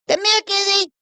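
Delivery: high-pitched summons to a pet; calling a cat over. Real, not acted. Streamer beckons the cat mid-stream, calling in a high voice. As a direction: coax the kitty over in a very high voice.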